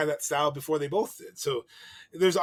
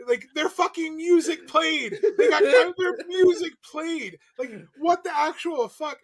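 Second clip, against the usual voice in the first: outraged; 11 semitones higher, 6 dB louder, slower than usual. Real, not acted. Outraged at the match ending.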